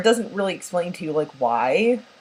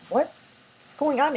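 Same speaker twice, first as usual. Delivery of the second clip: puzzled viewer; 8 semitones higher, much slower than usual. Retold or imitated. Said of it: "you're like".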